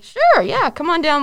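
high, loud hammed-up come on down; sarcastic, high cheer; mock enthusiasm. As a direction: toss it off like a game-show host. Mock game-show enthusiasm.

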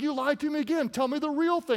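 a hint of a nagging woman; lightly voiced accusing Delilah. Preacher as Delilah pressing Samson, mild complaint.

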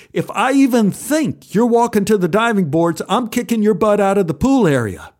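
stern, forceful threat; angry threat. Acted. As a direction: scold like a lifeguard at the end of patience. Lifeguard threatening the boy.

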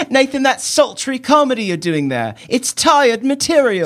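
put-on high, prim British kid voice; discerning British child. British child critiquing Nathan's comedy, high discerning kid voice. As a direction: review it like a tiny British critic. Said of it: "the most comedically discerning of children".

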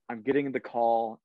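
ordinary delivery

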